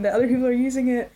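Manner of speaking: bright, quick burst of delight; thrilled that strangers use the yard; excited. Real, not acted. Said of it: "I'm so excited".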